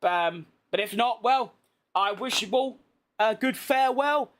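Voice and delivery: normal talking voice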